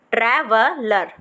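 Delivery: Traveller joined as one word, slowly. Shown as a demonstration. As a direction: put the pieces back together. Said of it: "पहले आप पूरी travel ल- learn करो". (जोड़कर धीरे से)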